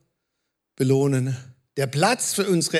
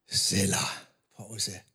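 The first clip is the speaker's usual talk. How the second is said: hushed; 11 semitones lower, 8 dB quieter, slower than usual. Shown as a demonstration. Reading the psalm pause.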